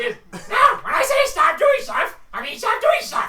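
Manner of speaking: very high, loud angry rant with a laugh; furious kobold. Acted. Kobold yells at the players who keep acting. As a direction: rant at them.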